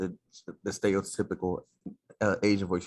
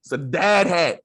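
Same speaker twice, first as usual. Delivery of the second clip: louder, higher insistence on "dad hat"; insistent; 9 semitones higher, 8 dB louder, slower than usual. Real, not acted. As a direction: shout "It's a dad hat" back at him. Arguing about the hat.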